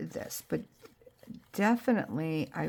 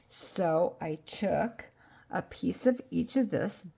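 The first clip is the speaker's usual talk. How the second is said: ordinary talk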